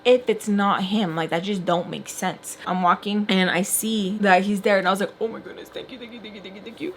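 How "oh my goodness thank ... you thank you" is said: rapid hushed thank-yous under the breath; barely audible grateful chant; hushed relief. Retold or imitated. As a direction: repeat thanks very softly, like a relieved prayer. Retold relief at being picked up.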